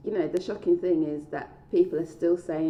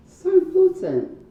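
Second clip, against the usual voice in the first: emphatic; 13 semitones higher, 10 dB louder than usual. Acted. Emphatic agreement from listener.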